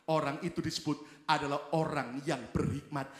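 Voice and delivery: ordinary talk, nothing marked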